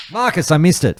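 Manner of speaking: high, loud cry of frustration; exasperated outburst. Real, not acted. Host to co-host after missing a mosquito, exasperated outburst. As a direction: call it out in real dismay.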